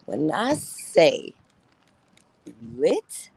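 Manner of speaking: excited club hype; hyped emphasis. Real, not acted. Hyping the Detroit club.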